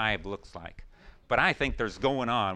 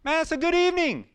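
thrilled; 5 dB louder than usual. Retold or imitated. Preacher quoting his younger self, thrilled after soul winning. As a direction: savour every word with glee. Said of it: "I was like".

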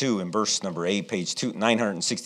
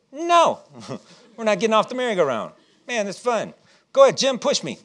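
put-on high, laughing little-boy defiance; cheeky kid refusal; 10 semitones higher than usual. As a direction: giggle through it like a stubborn kid.

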